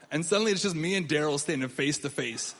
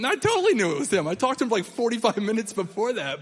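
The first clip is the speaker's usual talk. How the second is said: excited admission; 5 semitones higher, 5 dB louder than usual. Real, not acted. Laughing admission to audience.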